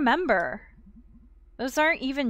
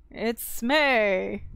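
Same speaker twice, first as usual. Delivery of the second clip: ordinary talk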